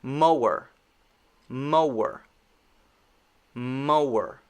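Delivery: "Mower" with lips rounding for the W. Shown as a demonstration. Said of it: "the next one".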